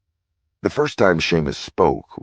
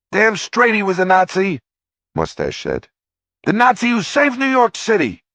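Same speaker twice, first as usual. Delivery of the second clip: much higher, louder defiant shout; mustached guy; 6 dB louder than usual. A narrator's reading. Mustached man retorting to the heckler, loud and defiant. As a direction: fire back, proud and defiant.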